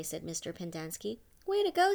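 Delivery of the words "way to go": high, loud burst of joy; excited cheer. Acted. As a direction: cheer him on like a buddy. Boys cheering Stanley's release.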